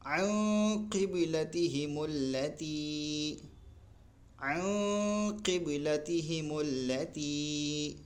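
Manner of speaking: عن قبلتہم اللتی chanted slowly with a nasal hum on عن; slow Quranic chant; slow recitation. Shown as a demonstration. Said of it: "ناک سے آواز نکالتے ہیں".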